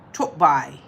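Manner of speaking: put-on practised Cockney "Top Boy"; Cockney accent. Shown as a demonstration. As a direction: try it in a low Cockney accent. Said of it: "now I'm doing a Cockney".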